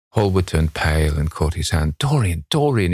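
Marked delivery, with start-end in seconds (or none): 2.0-2.9 high, mildly alarmed calling of a name; distressed cry. A narrator's reading. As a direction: plead with him, pitched up. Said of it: "he cried".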